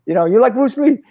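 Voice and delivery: high mock Jackie Chan voice; Jackie Chan imitation. Imitating Jackie Chan comparing him to Bruce Lee, high. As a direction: lift it way up as Jackie, lively. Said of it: "He's like".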